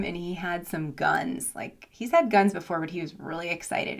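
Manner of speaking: ordinary talk, nothing marked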